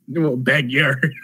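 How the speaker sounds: slow mock Aussie correction; slow hammed-up Australian; Australian bouncer. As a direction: drag it out like a stubborn outback doorman. Kangaroo bouncer voiced.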